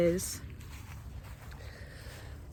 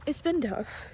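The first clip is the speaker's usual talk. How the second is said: voice breaking; 5 semitones higher, 7 dB quieter than usual. Real, not acted. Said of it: "I'm emotional because".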